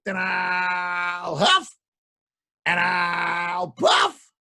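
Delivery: slow, stretched huff and puff; drawn-out wolf threat. Acted. Wolf threatening to blow the house down. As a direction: stretch each word like a menacing wolf.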